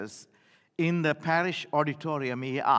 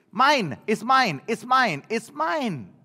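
a hint of a grabby toddler, higher; lightly voiced toddler; 6 semitones higher, 5 dB louder, slower than usual. Grandfather imitating his grandson claiming a toy, light toddler voice. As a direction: chant "mine" like a possessive little boy. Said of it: "my little grandson is learning to talk".

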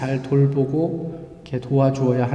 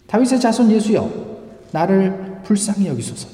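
crying out; 5 semitones higher than usual. Retold or imitated. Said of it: "소리를 지릅니다".

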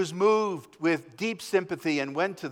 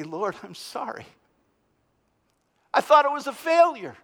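repentant plea; 9 semitones higher, 3 dB louder than usual. Pretend. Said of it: "look at the cross and say".

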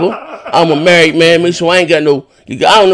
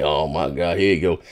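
low, much softer, tired sigh of a line; quoting my own weary reaction; exasperated mutter; 13 semitones lower, 10 dB quieter than usual. Retold or imitated. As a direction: mutter it like you've heard it all before.

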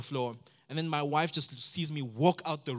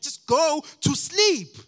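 put-on high, slow furious scream at the baby; 13 semitones higher, 13 dB louder, much slower than usual. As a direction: yell it out of pure frustration. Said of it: "and I just scream".

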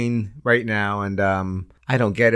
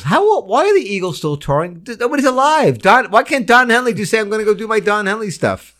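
exasperated; 9 semitones higher than usual. Real, not acted. Exasperated rant about Eagles.

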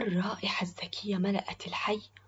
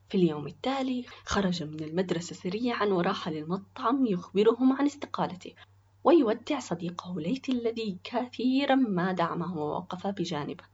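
plain reading voice

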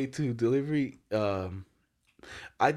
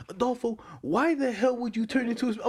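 high, indignant mock scolding; raised-pitch outburst, no real shouting; retold yelling; 10 semitones higher than usual. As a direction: imitate an irate coworker telling you off. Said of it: "you be yelling at me".

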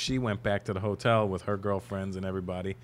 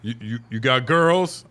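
doorman brother; 2 semitones higher, 9 dB louder, slower than usual. Retold or imitated. Voicing the big brother at door.

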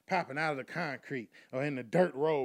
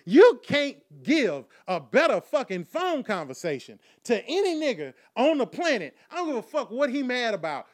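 ordinary delivery

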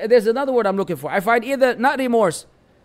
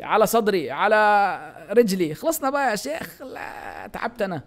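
low-pitched string of ritual pleasantries; mock Gulf-style welcome, pitched low; effusive Arabic greeter; 4 semitones lower, much faster than usual. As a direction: rattle off endless greetings, then wearily give up. Acts out endless Arabic greetings.

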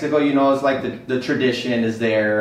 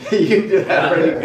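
laughing; 7 semitones higher, 5 dB louder than usual. Real, not acted. Echoing the compliment while laughing.

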